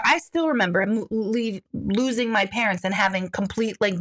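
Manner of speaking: normal talking voice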